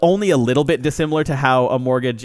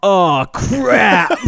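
louder, higher mock kid blurting "oh, crap"; kid's loud "crap"; 6 semitones higher, 6 dB louder than usual. Bored little cousin calling out to get laughs, loud.

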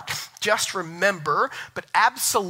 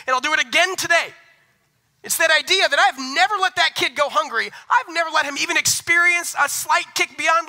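high, loud sermon climb; pastor's fiery sermon push; preaching at full force; 10 semitones higher, 5 dB louder than usual. Acted. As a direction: preach it hard and fast, building up. Preacher builds about hungry son.

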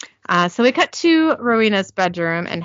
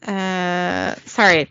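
ordinary talk

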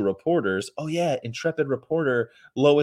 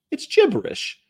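squeaky high-pitched mock; 4 dB louder than usual.

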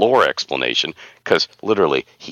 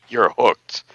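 ordinary talk, nothing marked

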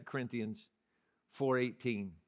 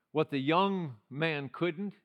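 ordinary talk

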